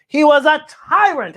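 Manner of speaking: a hint of the dog. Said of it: "Applesauce says".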